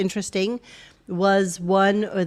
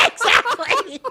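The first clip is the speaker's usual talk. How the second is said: laughing; 4 dB louder, slower than usual. Real, not acted. Laughing fit.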